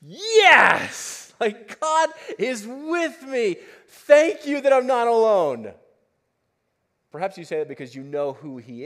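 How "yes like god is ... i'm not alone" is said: loud joyful cry, slowing on the middle line; excited. Acted. Preacher voicing a believer's joyful thanks. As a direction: burst out in glad relief.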